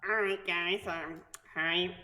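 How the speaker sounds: low, soft, understated goblin; deadpan goblin chairing a meeting. Acted. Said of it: "anytime a goblin shows up".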